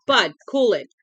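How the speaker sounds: sharp warning. A narrator's reading. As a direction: snap him out of it, firm. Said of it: "Ollie Weeks said sharply".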